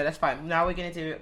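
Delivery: ordinary talk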